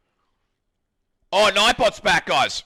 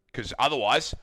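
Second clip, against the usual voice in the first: ordinary talk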